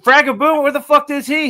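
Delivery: loud, high mock call for the creator. Fan yelling for the missing creator, loud and high. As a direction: yell out where the creator went.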